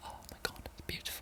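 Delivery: whispered awe at a lyric; awed whisper. Real, not acted. Reacting to a love song, an awed whisper. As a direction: breathe it out in a hushed whisper.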